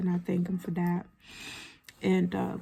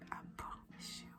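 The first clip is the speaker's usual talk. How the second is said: whisper; 12 dB quieter, faster than usual. Real, not acted. Whispered promise to viewers.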